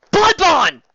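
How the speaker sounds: loud, high shout of a name. Acted. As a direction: yell her name to cut her off. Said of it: "Lyra shouted".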